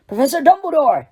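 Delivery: startled call, voice lifted; alarmed Hagrid calling out; surprised. A narrator's reading. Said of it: "his eyes widening".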